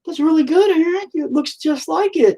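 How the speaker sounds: mocking flatterers. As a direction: sing out the fake compliment. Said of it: "people are like".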